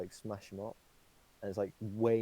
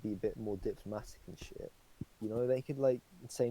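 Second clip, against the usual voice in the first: usual speaking voice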